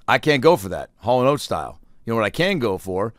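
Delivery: usual speaking voice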